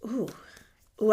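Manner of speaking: normal talking voice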